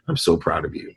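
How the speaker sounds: soft, hushed and tender; tender praise. Retold or imitated. Father softly praising daughter on the phone, hushed and tender. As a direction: quietly tell her you're proud.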